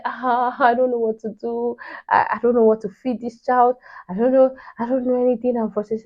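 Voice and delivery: high, whiny mock crying; retold tearful frustration. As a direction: imitate helpless wailing, without real tears. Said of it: "then I started to cry".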